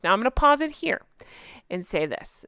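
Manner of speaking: ordinary delivery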